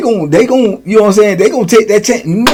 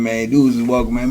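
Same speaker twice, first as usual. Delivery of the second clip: usual speaking voice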